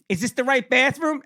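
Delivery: high-pitched mock mom voice; imitated mother. Mother voiced in kindergarten story.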